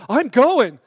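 blurted loud and eager; excited friend volunteering at full voice; eager shout. Retold or imitated. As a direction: jump in, all in, loud.

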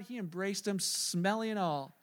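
ordinary delivery

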